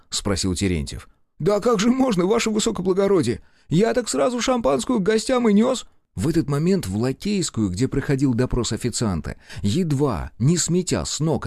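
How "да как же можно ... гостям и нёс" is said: high, pleading servant's voice; a nervous lackey explaining himself; a sniffling waiter justifies himself to the officers, high and meek; timid young waiter. A narrator's reading. Waiter protests.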